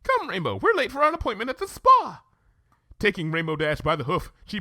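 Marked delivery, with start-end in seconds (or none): 0.0-2.2 much higher, dramatic alarm; Rarity, startled and hurried; flustered Rarity. Acted. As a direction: gasp out the lateness.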